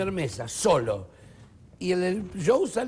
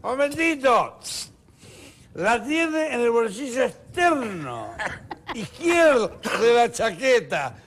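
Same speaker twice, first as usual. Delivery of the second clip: put-on slow, loud, higher drunk know-it-all; drunk heckler; 6 semitones higher, 5 dB louder, slower than usual. Drunk at the front table heckling again, slow and loud. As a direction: drawl the correction like a drunk.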